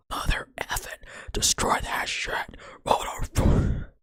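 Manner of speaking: raspy, unvoiced bird swearing; cussing parrot. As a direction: imitate a parrot cussing under a harsh whisper. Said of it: "the bird was cussing like a sailor".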